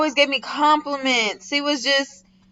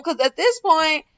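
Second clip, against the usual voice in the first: ordinary talk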